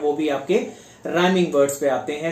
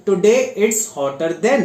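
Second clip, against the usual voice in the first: slow and deliberate, word by word; teacher's slow example read; slow dictation; 3 semitones higher, much slower than usual. Shown as a demonstration. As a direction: spell it out slowly for the class. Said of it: "teacher dictates example sentence".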